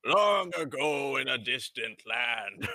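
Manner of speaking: epic narrator voice. Pretend. Samurai Jack intro parody.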